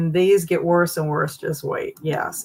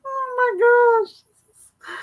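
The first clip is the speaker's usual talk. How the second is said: very high, slow, stretched gasp; shocked; 3 dB louder, much slower than usual. Real, not acted. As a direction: stretch it out in disbelief. Shocked by next picture.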